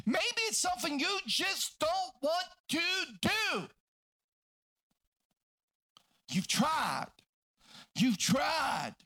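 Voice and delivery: high, impassioned pulpit cry; full-force preaching. Acted. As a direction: preach hard, punching each phrase.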